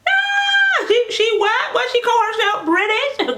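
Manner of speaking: very high, loud squawk of disbelief; shocked viewer. Acted. Imagined viewer reacting to her being British, mock shocked shriek. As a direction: shriek it like a scandalised onlooker. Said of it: "I know some people, their hair is gonna be".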